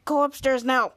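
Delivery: plain reading voice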